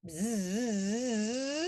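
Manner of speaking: sustained buzz sweeping upward; long bee buzz. Shown as a demonstration. Teacher telling of a mighty wind, rising buzz. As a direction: buzz that rises like a gust.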